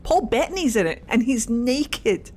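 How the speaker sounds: ordinary talk, nothing marked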